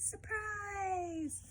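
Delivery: usual speaking voice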